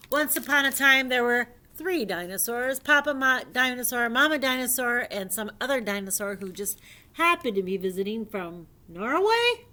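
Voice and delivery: animated, emphatic read-aloud; lively storyteller lifting key words; storybook reading. Acted. Reading a dinosaur fairy tale to young friends, animated storybook reading.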